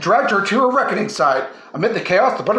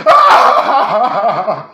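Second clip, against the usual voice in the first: loud laughing scream of a falling man; hammed-up falling shriek; 3 semitones higher, 7 dB louder than usual. A narrator's reading. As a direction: let out a big comic yell as you drop. Fred screaming while falling.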